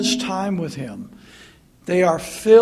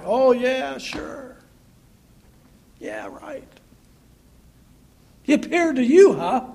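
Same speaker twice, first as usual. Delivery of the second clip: sarcastic disbelieving disciples, a bit higher; mock doubting; 4 semitones higher than usual. Doubting disciples mocking Peter, sarcastic. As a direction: roll your eyes at Peter's claim. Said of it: "the doubting of the disciples".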